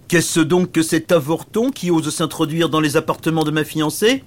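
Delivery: high-pitched indignant oaf; blustering dumb knight, voice pushed up; big stupid knight, outraged. Acted. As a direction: demand an explanation as an affronted fiancé. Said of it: "Le grand chevalier, costaud et stupide".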